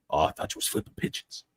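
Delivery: whispered, teasing reply; whispered mock quote. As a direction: tease a friend on the sly. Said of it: "dialogue joke".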